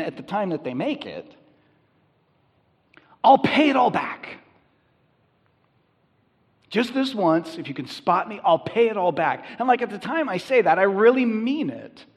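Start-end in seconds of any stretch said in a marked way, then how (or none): 3.2-9.5 higher and louder, an earnest promise to repay; pleading promise. Pretend. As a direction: beg for a loan, swearing you'll pay.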